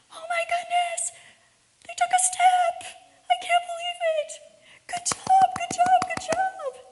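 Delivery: put-on very high, loud cooing over a baby's first step; gushing baby praise. Parent cheering a toddler's first step, gushing high. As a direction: gush like a thrilled parent.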